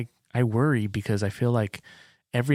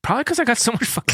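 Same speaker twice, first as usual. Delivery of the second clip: excited; 13 semitones higher, faster than usual. Real, not acted. Excited blurt of the reason.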